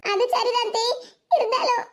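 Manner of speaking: acted sniffly, hesitant reply; half agree through tears, pitch lifted; tearful. Girl being consoled by her aunt, tearful. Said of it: "இப்படி சும்மா அழுதுகிட்டு இருக்காத".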